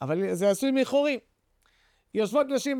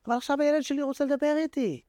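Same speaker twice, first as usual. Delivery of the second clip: lightly voiced God, higher, moved and tender; 6 semitones higher than usual. God tells the angels his child wants to talk, higher and tender. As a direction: speak as a loving father hearing his child. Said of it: "אומר הקדוש ברוך הוא".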